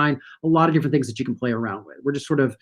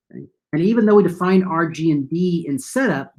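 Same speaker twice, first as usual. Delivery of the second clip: ordinary talk, nothing marked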